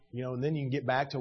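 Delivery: normal talking voice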